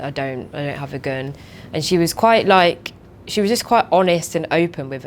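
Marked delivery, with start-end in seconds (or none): 0.0-1.3 softer, subdued denial; low, quiet and down. Retold or imitated. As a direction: say it flat and deflated, no energy. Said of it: "she was very kind of low".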